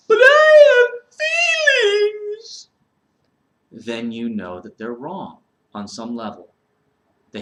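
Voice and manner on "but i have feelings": high, loud, slow hammed-up sob; mock crying. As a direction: wail it like a fake crybaby. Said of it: "summed up by this moment".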